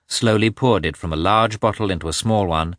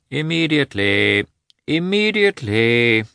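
unhurried reply from another room; a lightly voiced doctor, smiling; calm reply; 7 semitones higher, 4 dB louder than usual. A narrator's reading. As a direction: answer calmly, smiling a little. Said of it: "answered a voice".

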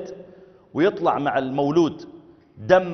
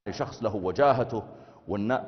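lower, soft self-important murmur; a touch of vanity muttered; arrogant inner voice; 5 semitones lower, 7 dB quieter than usual. Pretend. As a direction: dismiss the humble invite. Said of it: "فقلت في نفسك".

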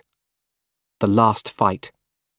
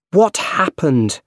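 higher, slow urgent shout; 7 semitones higher, slower than usual. A narrator's reading. Peter shouting to Aunt May, higher and slow. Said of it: "shouted Peter".

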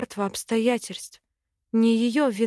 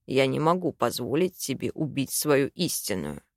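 low, firm Simon; 8 semitones lower than usual. Acted.

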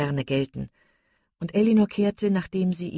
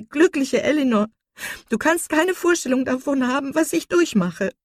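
high, loud, anguished reproach; desperate outburst; 5 semitones higher, 6 dB louder than usual. A narrator's reading. Marian rejects her sister's talk of self-control, desperate outburst. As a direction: lash out in despair, voice pitched up. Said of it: "rief Marian".

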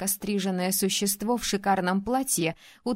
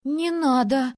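a higher, louder frightened squeak; 4 semitones higher, 5 dB louder than usual. Acted. Princess startled by the ruler's plan, squeaking in alarm. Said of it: "пискнула я".

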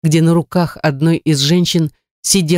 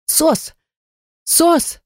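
very high-pitched SOS cries; distress call; 12 semitones higher than usual. A narrator's reading. Vlada radios for rescue, urgent distress call. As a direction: cry each one out separately, pitched right up.

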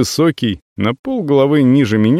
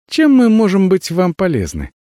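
cartoon princess; 9 semitones higher than usual. Avella sweet to the visitor.

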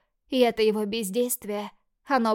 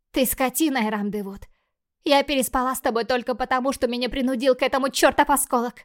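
loud, higher furious defiance; angry; 3 semitones higher, 6 dB louder than usual. Acted. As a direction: hurl it at him in rage. Michelle defies the demon.

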